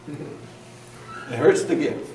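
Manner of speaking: slow, breathy whimpered "It hurts to give"; playful mock whimper. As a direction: pretend to whimper in pain. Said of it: "I know sometimes we say".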